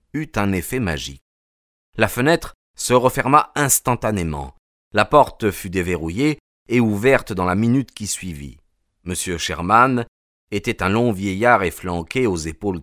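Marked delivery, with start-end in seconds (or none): none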